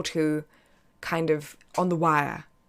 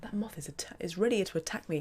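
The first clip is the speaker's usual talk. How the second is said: soft unvoiced whisper, playing terrified; mock scared whisper; 2 semitones higher, 8 dB quieter than usual. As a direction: hush it as if the moth might hear. Scared of a moth on stream.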